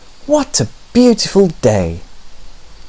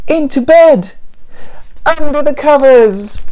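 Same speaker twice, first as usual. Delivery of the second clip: mock panic; 7 semitones higher than usual. A narrator's reading. Panicked escape home.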